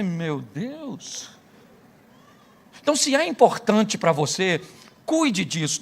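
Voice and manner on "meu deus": soft dismay. Retold or imitated. Pastor reacting to infertility.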